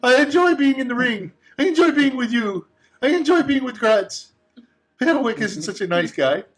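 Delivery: high, desperate begging to stay; clinging to home; pleading bust. Acted.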